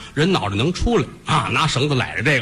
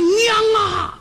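crying shout. Acted. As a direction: scream for his mother as she dies. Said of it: "小宝大喊一声".

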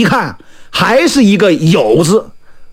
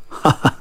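soft short chuckle; light, knowing little laugh; slight chuckle; 7 dB quieter than usual. Acted. Fortune-teller hears customers grumble, chuckles slightly. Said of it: "李太白微微一笑".

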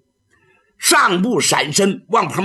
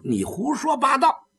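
angry retort; 8 semitones higher than usual. Acted. Girl angrily cuts him off.

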